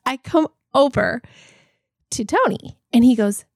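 ordinary delivery

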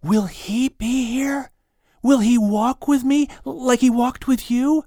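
put-on a boy asking in wonder; young boy voice. A narrator's reading. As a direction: ask like an awed little boy. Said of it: "He whispers".

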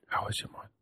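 breathless hushed whisper. Shown as a demonstration. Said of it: "don't whisper either".

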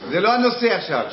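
loud, high, insistent repetition. Real, not acted. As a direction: shut the interruption down forcefully.

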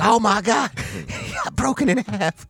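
high excited wrestling call; wrestling commentator. Retold or imitated. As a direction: hype it like a WWE announcer. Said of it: "they put the W W E dub to it".

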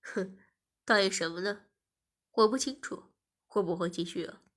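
low male character, smug teasing; amused taunting. Acted. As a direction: tease with a scornful huff. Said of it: "技师笑着说".

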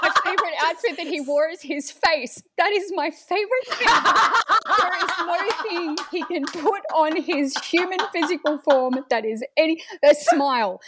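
high, laughing gush; giddy, laughing fangirl praise. Real, not acted. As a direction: giggle while you rave about him. Gushing about actor's smile.

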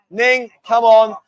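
loud, drawn-out call to someone across the room. Real, not acted. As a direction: call her over, slow and loud. Calling Ning over to camera.

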